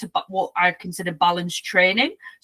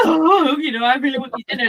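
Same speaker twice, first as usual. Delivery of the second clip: higher "oh", then a quick plea; begging dog; 4 semitones higher, faster than usual. Retold or imitated. Dogs begging owner at dinner, mock pleading. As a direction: imitate a dog angling for food.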